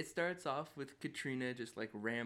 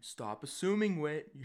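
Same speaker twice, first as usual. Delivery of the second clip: loud, higher-pitched mock telling-off; frustrated scolding; 5 semitones higher, 9 dB louder, slower than usual. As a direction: tell them off in exasperation. Scolding a character as listener.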